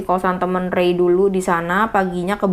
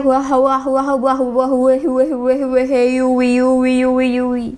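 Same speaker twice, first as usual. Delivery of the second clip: muffled wah wah talk; 5 semitones higher, slower than usual. Acted. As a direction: babble like someone possessed.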